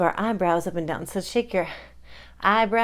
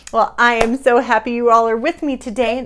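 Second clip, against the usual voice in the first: ordinary delivery, nothing marked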